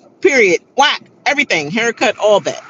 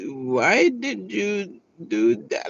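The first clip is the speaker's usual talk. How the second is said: imitating a slow mother, softer and drawn out; slow-talking mother; 6 dB quieter, much slower than usual. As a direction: drag every word out very slowly. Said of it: "the mother talks so slow".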